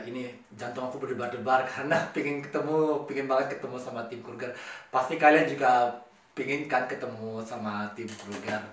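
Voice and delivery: ordinary talk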